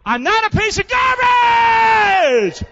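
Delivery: high, loud, slow shouted cry. Real, not acted. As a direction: throw it out with a full-throated yell. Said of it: "Here we go".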